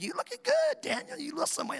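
hammed-up sing-song flattery; mock high compliment. Somebody complimenting Daniel on weight loss, high mock falsetto.

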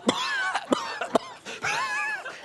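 acted coughing on dust; imitated coughing. Roof dust falls on listeners, mock coughing fit. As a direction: cough and sputter for show.

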